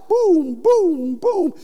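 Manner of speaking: high-pitched booming alert sound; imitated phone alarm. Preacher imitating an Amber Alert going off, high alarm tones. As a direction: mimic an insistent alarm in high, even pulses. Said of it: "it'll come on and it's really loud".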